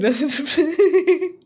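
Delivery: high burst of real laughter; loud laugh. Real, not acted. Streamer laughing at the AI king joke, loud laugh. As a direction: crack up loudly.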